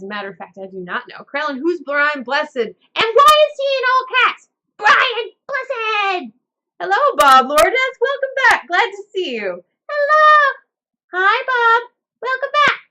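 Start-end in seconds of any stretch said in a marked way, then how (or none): 1.2-4.5 high, loud puppet character; excited puppet voice. Acted. Puppet asks about name. 4.8-6.3 high, loud proclaimed name; high shout. Acted. Booming the actor's name. 6.8-12.8 high, loud cheery puppet voice; loud puppet greeting. Acted. Puppet greets viewer loudly.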